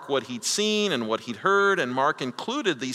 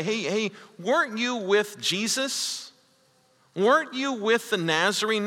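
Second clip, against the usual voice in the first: put-on questioning servant girl; 7 semitones higher than usual. Shown as a demonstration. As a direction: ask it as a real question. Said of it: "The servant girl doesn't say".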